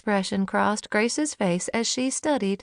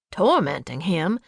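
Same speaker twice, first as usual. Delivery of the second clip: lightly indignant low echo; 3 semitones lower than usual. A narrator's reading. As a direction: repeat it, offended. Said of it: "Daddy sounded indignant".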